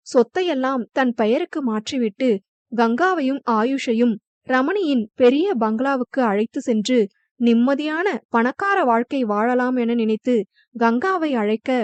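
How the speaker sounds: usual speaking voice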